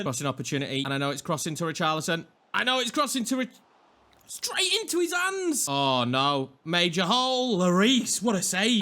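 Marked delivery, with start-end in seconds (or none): 2.5-5.7 high, stretched cry at a blown chance; exasperated cry. Real, not acted. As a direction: voice climbs sharply in dismay. Cross goes straight to keeper.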